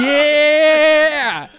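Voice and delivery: long, high, loud whoop of "yeah"; shouted cheer. Real, not acted. Host cheering at the sign-off, long loud shout.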